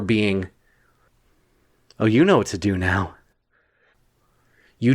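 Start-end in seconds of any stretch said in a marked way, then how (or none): none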